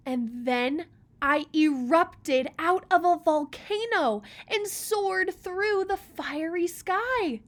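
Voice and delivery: loud, rising with excitement; dramatic. Acted. Old Rock recalling his eruption, loud and thrilled. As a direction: boom it out like the eruption itself.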